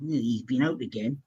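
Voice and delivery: hushed, disapproving aside; gossiping neighbour. Retold or imitated. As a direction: imitate a curtain-twitcher passing on gossip. Voicing curtain-twitching neighbours.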